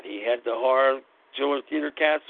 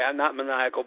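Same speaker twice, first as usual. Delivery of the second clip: usual speaking voice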